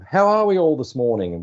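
imitating an oblivious, big hello, pitched well up; mock-jolly high-pitched greeting to a crowd; big cheery greeting. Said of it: "gave them a big".

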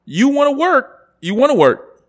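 loud and high, incredulous; heated rant. Real, not acted. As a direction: push it up, almost yelling in disbelief. Heated rant about partnership.

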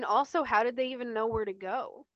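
can't believe the show skipped this; exasperated question, half laughing; incredulous. Real, not acted. Incredulous plot-hole rant.

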